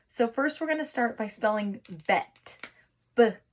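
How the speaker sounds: ordinary talk, nothing marked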